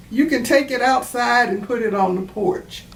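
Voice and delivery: ordinary delivery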